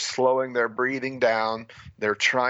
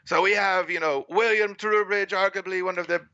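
higher, hammed-up TV announcer intro; 5 semitones higher than usual. Recalling the freediving broadcast, a mock TV announcer intro. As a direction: introduce the two divers like a sports broadcaster. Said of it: "So the announcer's like".

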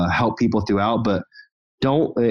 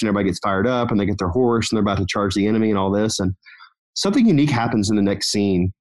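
ordinary talk

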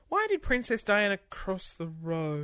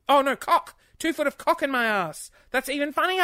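high, loud delighted realisation; giddy at the correction; excited; 6 semitones higher, 6 dB louder than usual. Real, not acted. Realising the misread joke.

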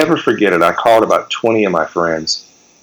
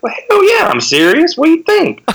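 hammed-up gruff rocker bravado; imitating Ted, brash and high. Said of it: "He's like".

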